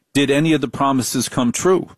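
ordinary delivery

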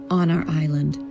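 plain reading voice

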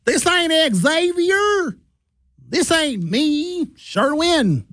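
very high, slow mock-Southern drawl; hammed-up Southern accent, stretched and high-pitched. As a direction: imitate a terribly faked Southern caller.

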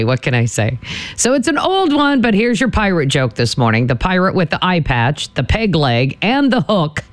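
ordinary talk, nothing marked

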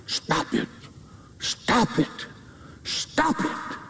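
intense, voiceless stage whisper; hushed, desperate plea of conscience; whispered scream. Acted. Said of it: "Your conscience is screaming".

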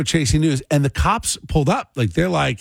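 ordinary talk, nothing marked

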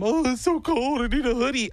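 high-pitched imitation of a chilly friend; mock complaining. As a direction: mimic a friend griping, voice pushed way up. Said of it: "he complains all the time".

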